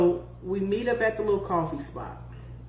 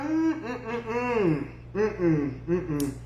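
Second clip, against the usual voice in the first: mock hums; 4 dB quieter, slower than usual. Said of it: "I was like".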